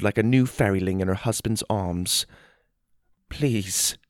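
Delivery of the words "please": soft, fragile plea; tearful pleading. A narrator's reading. Gobley begs Theodore to heal his wife, tearful plea. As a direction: beg softly, close to tears. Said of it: "Gobley pleaded with tears in his eyes".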